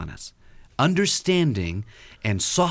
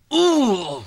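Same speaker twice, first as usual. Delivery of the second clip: frustrated ooh; 12 semitones higher, 5 dB louder than usual. Retold or imitated. Wife's frustrated sound.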